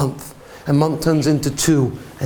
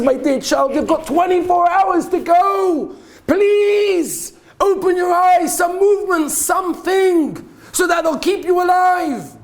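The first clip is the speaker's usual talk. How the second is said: desperate pleading; 13 semitones higher, slower than usual. Acted. Mother holding her son's hand, crying out in pleading. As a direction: wail it slowly like a mother at her last hope. Mother begging son to wake.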